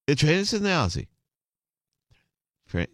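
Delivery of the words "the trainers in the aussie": higher-pitched imitation of a thick Scouse accent; Liverpool accent. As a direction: mimic a Scouse stage manager, words run together. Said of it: "the stage manager said".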